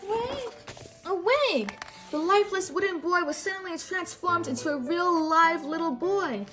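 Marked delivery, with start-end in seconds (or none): 0.1-1.7 Awake. said high and light; reading aloud to children, modelling Awake; demonstrating the word. Shown as a demonstration. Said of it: "Can you say awake?".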